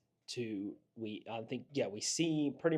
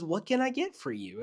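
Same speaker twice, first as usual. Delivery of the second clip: fake-nice drive-thru voice; 9 semitones higher than usual. Drive-thru worker greeting a customer in a fake-nice voice. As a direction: chirp it like a cartoon fairy. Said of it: "that fanfare".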